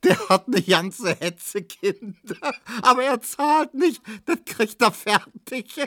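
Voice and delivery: high squealing amusement; roaring with laughter. A narrator's reading. Neumann joking about Kubinke's children, roaring with laughter. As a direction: force the words through helpless laughter. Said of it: "Neumann lachte, dass die Scheiben zitterten".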